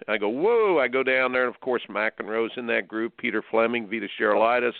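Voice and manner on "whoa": surprised whoa. Retold or imitated. Reacting to job offer.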